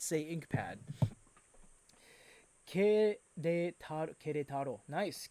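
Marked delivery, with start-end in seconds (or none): 2.7-4.8 Querétaro as "keh-DEH-ta-ro", trying it carefully; corrected pronunciation. Shown as a demonstration. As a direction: sound out Querétaro starting with a plain "ke", no w. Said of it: "Oh, you don't say que".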